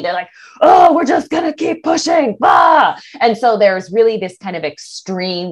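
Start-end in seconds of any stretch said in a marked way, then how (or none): 0.6-2.4 higher, louder brush-off; mock pushy shout. Driven client overriding her body. 2.4-3.0 short loud "Ah!" cry; mock shout. Shout after acted quote.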